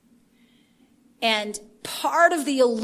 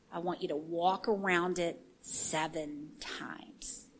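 slow hushed whisper of God's instruction; secretive whispered order; 7 semitones lower, 12 dB quieter, slower than usual. Acted. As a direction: lean in and breathe the command. God instructing Joshua's war strategy.

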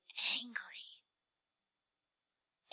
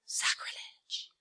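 hushed, scandalized whisper; mock scandalized whisper; 8 dB quieter, slower than usual. Preacher mocking the idea that dancing is wrong, whispered. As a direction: whisper it like a shocked church lady. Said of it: "mock scandal".

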